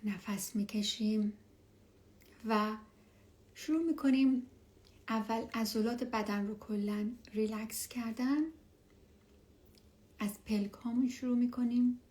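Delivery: hushed and calm, guide them down; spa whisper. Acted. Leads a meditation.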